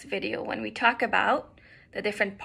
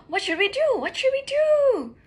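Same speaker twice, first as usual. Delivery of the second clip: frantic, high-pitched loud wail; panicked; 13 semitones higher, 8 dB louder than usual. Acted. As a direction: cry out in panic, stretching the last word high. Duck runs in circles in panic.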